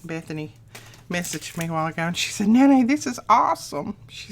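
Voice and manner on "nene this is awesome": excited granddaughter. Retold or imitated. Granddaughter's excited message retold.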